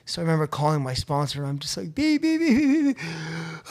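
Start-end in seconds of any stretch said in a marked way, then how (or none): 1.9-3.7 high, squeaky bleeps; mock censor beeps. Calling his sponsor, bleeping out his cursing. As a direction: bleep out the swearing.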